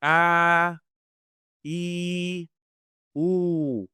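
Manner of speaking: long held vowels a, e, u; clear vowels. Shown as a demonstration. Teacher leading students in vowel practice. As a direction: sustain each vowel cleanly. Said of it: "let's try saying".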